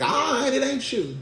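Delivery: high put-on voice; skeptical backing off. Accuser pretending to accept Peter's denial, high-voiced concession. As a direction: give in without believing it.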